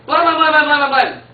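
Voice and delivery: high, loud rapid-fire door knocks; hammering sound effect; loud knocking imitation. A narrator's reading. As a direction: bang on the door with your voice.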